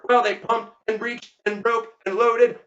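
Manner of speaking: plain reading voice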